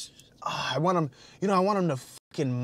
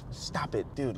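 whispered; 5 semitones lower, 8 dB quieter than usual. Real, not acted. Scolding himself.

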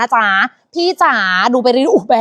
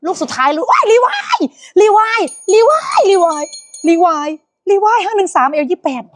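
much higher squealing repeats; excited squeal; 6 semitones higher than usual. Real, not acted. Host squeals the brand to viewers, much higher.

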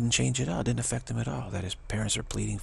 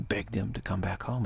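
usual speaking voice

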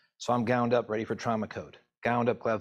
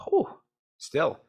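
emotional sigh; 13 semitones higher than usual. Real, not acted. Overwhelmed by the memory.

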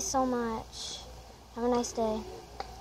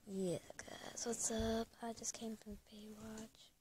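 whisper; 14 dB quieter than usual. Real, not acted. Filming secretly, whispering to camera.

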